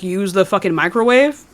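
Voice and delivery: ordinary talk, nothing marked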